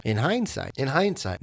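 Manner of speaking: ordinary talk, nothing marked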